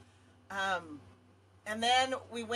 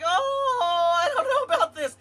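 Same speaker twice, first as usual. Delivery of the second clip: nervous laughing yelp; 10 semitones higher, 15 dB louder, slower than usual. Retold or imitated. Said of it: "Mom was like".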